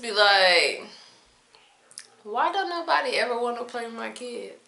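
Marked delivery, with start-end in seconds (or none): 2.2-4.6 higher-pitched, puzzled plea; baffled complaint. Retold or imitated. Mother wondering about kids shunning her daughter, baffled. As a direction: retell a hurt, bewildered question. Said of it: "I just don't understand".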